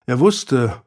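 usual speaking voice

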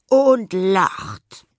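high, loud burst of laughter; laughing. Acted. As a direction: laugh through the words. Narrator performs the laugh.

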